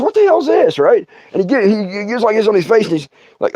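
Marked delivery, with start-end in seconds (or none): 0.0-0.8 higher, startled question; a coworker blurting out in shock. Retold or imitated. Coworker on the window sees the thumbnail, startled high question. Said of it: "the dude over here goes".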